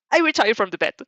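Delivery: loud flustered retreat; alarmed refusal. Acted. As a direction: back out fast, flustered. Panicked backing out of bet.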